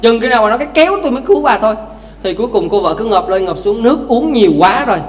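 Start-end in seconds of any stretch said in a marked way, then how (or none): 0.6-1.7 husband's condition. Acted. Husband's condition, inner thought.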